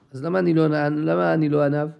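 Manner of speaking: ordinary delivery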